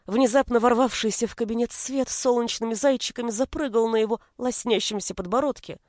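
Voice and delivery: usual speaking voice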